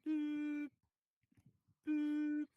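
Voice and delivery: high doop beeps like a phone line ringing. Pretend. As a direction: imitate the ringing tone.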